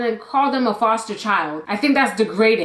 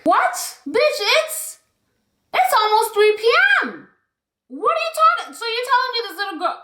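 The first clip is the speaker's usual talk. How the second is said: real shocked outrage, much higher; outraged disbelief; 10 semitones higher than usual. Real, not acted. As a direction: burst out in disbelief, pitched way up. Outraged at denying a child water.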